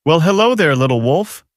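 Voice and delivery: lightly voiced rogue captor, pitched high at the start; a hint of a smirking villain, high then dropping; rogue's voice. A narrator's reading. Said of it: "I could hear the rogue's voice".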